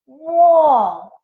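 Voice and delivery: high, loud amazed whoa; big wide-eyed whoa. A narrator's reading. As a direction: gasp it out in wonder. Narrator marvels again.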